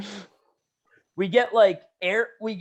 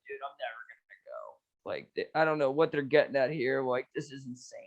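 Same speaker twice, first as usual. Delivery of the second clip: much softer, lower muttered complaint; hushed aside; 6 semitones lower, 9 dB quieter than usual. Retold or imitated. Lying in the hospital bed whispering to his buddy, hushed. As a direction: say it under your breath to your buddy. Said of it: "I'm like".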